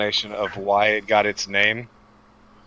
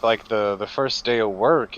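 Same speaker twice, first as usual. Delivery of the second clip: ordinary talk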